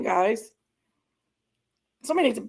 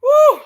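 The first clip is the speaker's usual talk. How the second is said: high, loud venting whoop; real exasperated whoop; calming-down whoo; 10 dB louder than usual. Real, not acted. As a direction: let the anger out in one high "woo". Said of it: "I'm gonna calm down a minute".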